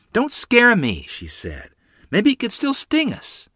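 put-on high-pitched child's protest; alarmed little girl. A narrator's reading. Lottie shrinks from the scorpion, alarmed high child voice. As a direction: squeal it like a worried little sister.

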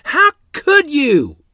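high, loud, slow anguished yell; Mother yelling at Father, high and loud. A narrator's reading. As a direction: scream it at your husband. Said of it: "we heard Mother yelling".

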